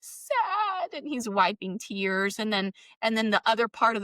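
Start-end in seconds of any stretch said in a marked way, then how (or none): 0.0-0.9 put-on a tearful five-year-old admitting it; sad little boy. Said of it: "And he goes".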